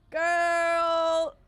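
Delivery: loud, drawn-out protest; stretched, overwhelmed holler; overwhelmed exclamation. Real, not acted. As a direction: hold the word long and loud. Overwhelmed by another gift.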